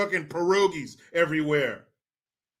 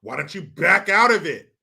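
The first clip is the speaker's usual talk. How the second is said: normal talking voice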